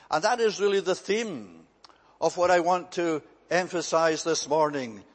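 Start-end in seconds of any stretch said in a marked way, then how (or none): none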